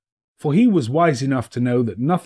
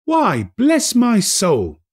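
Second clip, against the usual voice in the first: high, slow, astonished exclamation; Fred startled at his uncle; bless-my-soul surprise; surprised cry; 12 semitones higher, 5 dB louder, much slower than usual. A narrator's reading.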